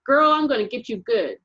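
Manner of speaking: put-on boy chasing a girl, yelling. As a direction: yell it while giving chase. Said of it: "as he yelled".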